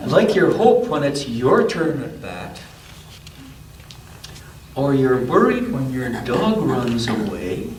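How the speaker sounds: gentle storybook read-aloud; unhurried picture-book narration; reading to a child. A narrator's reading.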